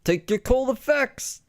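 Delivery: high, slow put-on pitchman voice; Quiznos ad guy. Bit recalling a Quiznos ad, done as a high, slow mock pitchman. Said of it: "He was like".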